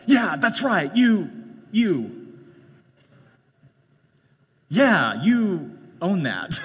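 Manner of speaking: gloating spouse. Retold or imitated. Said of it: "what's the spouse gonna do".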